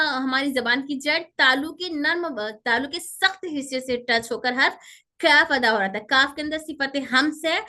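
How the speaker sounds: ordinary talk, nothing marked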